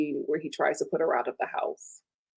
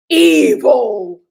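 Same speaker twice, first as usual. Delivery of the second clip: loud shout of "Evil"; shouted verdict; 2 semitones higher, 13 dB louder than usual. Real, not acted. Vlogger shouting her verdict on the husband. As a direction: bellow it as a verdict.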